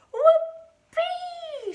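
put-on very high, loud gleeful old-lady whoop; shouted whoop. A narrator's reading. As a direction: let out a piercing whoopee. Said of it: "she shouted".

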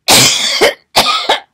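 loud, sudden cough. Real, not acted. Woman breaking off her Bible talk, coughing. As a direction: cough hard mid-thought. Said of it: "Excuse me".

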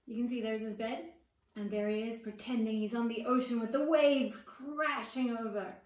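plain reading voice

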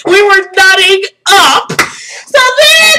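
loud, high, bursting with excitement; overexcited. Real, not acted. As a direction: shout it, nearly losing it to laughter. Overcome with excitement retelling it.

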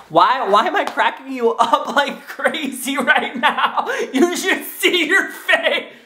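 high laughing squeal, drawn out; laughing hard. Real, not acted. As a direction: laugh through every word. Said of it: "hurt my stomach to talk like that".

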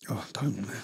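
hushed, choked-up near-whisper; almost voiceless, struggling to go on; overcome with emotion. Real, not acted. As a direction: barely get it out, upset. Overcome recalling his son.

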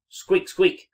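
high, loud squeaks; little mouse squeak. A narrator's reading. Little mouse peeping out of his hole, squeaking. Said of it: "said a little mouse".

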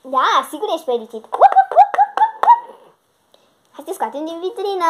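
normal talking voice

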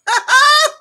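high shrieking burst of laughter. Real, not acted. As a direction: let out a loud squealing laugh. Laughing at chat comment.

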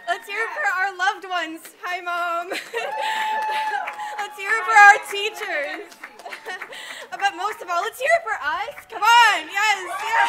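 loud hype calls; rally the crowd, shouting; cheering. Real, not acted. Leading cheers.

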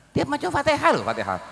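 ordinary talk, nothing marked

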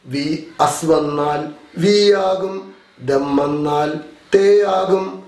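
slow, measured recitation in pairs; rote drill. Shown as a demonstration. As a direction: draw out each rule for repeating. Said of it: "ഒന്നുകൂടെ പറയാം".

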